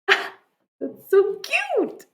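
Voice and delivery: high, loud, drawn-out squeal over something cute; gushing. Real, not acted. Streamer delighted by everyone's different hearts, gushing.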